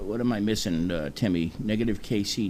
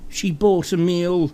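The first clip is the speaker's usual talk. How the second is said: dramatic British reporter; 7 semitones higher than usual. Acted. Host parodying BBC coverage of a fast-food story, overdramatic British reporter. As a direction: report it like the end of the world. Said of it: "it would sound sort of like".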